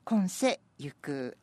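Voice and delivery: slow, measured haiku reading; poem recitation. Acted. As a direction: read each line slowly, pausing. Reads senryu again.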